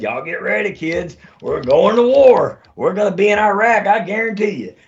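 hammed-up old officer barking at the troops; imitating gruff commander. Commander telling his battalion they're going to war, hammed-up gruff voice. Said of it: "he talked just like that too".

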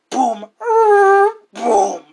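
loud, high imitated booms of furniture banging; shouted boom noises overhead; mock booming thuds. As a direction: belt out the thumps from upstairs.

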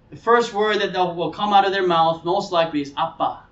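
usual speaking voice

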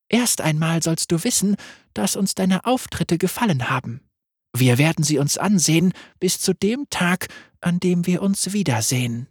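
deutlich höher, sanft und verspielt; helle Lamm-Stimme. Acted. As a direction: ein unheimlich freundliches Lamm. Lam voice again. (Lamm stellt sich vor, hoch und sanft)

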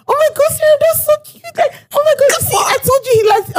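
very high-pitched gushing impression; giddy, excited. Client gushing to her planner about the man, giddy and excited. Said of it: "my client is all giddy and excited".

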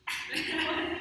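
quick rising chuckle; short laugh. Real, not acted. Co-host laughs while asking about cleaning up, short chuckle. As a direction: laughs at the gross detail.

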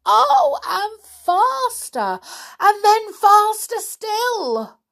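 high, loud, thrilled storytelling; excited rising narration. A narrator's reading. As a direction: build the thrill to a peak. Climax of spinning narration.

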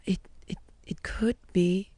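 put-on a frightened child's faint whisper; scared whisper. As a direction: barely breathe the words, scared and small. Lou answering the principal.

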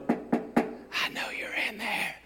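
hushed, slow, breathy whisper. Retold or imitated. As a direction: whisper it through the door. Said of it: "when they knock on the door".

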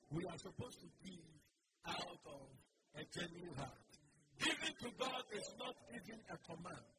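ordinary talk, nothing marked

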